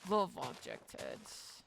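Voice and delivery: deep, drawn-out repeat; low slow voice. Shown as a demonstration.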